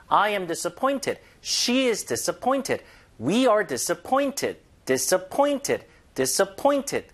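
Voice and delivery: each word drawn out very slowly, stress on dis-ap-POINT-ed; slow stressed drill. Shown as a demonstration. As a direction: model "disappointed" slowly with clear stress on POINT. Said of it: "강세 주시면서 들어보세요". (아주 천천히 강세를 살려서)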